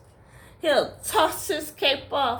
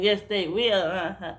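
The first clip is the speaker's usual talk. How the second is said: ordinary delivery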